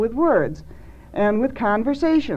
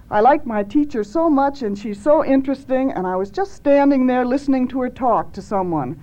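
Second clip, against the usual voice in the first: put-on higher, plaintive retelling of a child; upset child; 4 semitones higher than usual. Young girl tells her mother about school, upset child. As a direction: gently imitate a hurt schoolgirl, pitched up. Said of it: "very much upset and said this".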